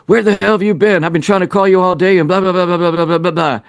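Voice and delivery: high-pitched, rushed angry rant; mad, accusing. Acted. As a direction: act out an exasperated telling-off, tailing into rapid blahs. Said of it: "he's mad at you".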